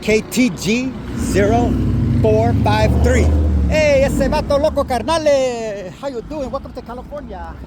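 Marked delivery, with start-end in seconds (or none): 3.7-7.5 hammed-up Chicano accent, teasing a passing driver; mock Chicano accent. Filming vlogger greets Texas driver in mock Chicano accent.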